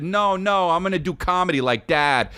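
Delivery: put-on high-pitched little-kid protest; Calvin. Dad quoting his son's answer to mom, high child voice. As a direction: answer high, like a small boy. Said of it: "And he said".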